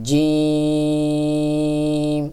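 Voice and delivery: "জিম" with a full j and a very long "i"; thick sound. Shown as a demonstration. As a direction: hold "জিম" out, steady and thick. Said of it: "আওয়াজ মোটা হবে".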